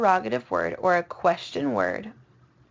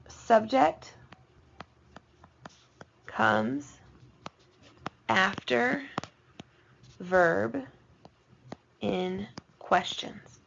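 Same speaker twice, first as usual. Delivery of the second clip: dictating slowly, word by word; much slower than usual. Shown as a demonstration. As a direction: dictate one word at a time, long pauses. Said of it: "write down".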